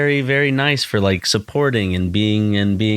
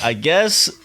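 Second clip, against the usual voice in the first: mock I guess; 7 semitones higher than usual. Mimicking his shrug.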